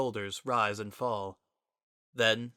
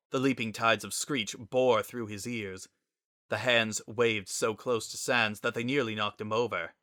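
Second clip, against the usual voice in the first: plain reading voice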